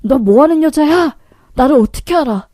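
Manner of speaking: acted angry outburst; angry yell. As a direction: demand answers from a stranger, affronted. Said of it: "라고 소리 지르자".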